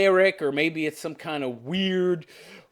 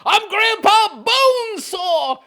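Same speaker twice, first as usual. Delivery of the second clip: high, loud, drawn-out mimicking yell; Grandpa Bonesaw; 7 dB louder, much slower than usual. Reactor mimicking the attacker's cry, mock yell. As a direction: stretch the words in a hammed-up high shout. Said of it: "He's Grandpa Bonesaw. Did you hear that?".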